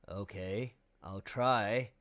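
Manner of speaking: lightly voiced dopey reluctance; melon voice. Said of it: "in my melon voice".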